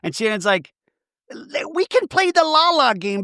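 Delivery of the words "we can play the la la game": high-pitched mock protest; hammed-up high squeal of protest; indignant mock retort. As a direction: imitate an affronted housewife way up high. Imitating Shannon's indignant retort in recap.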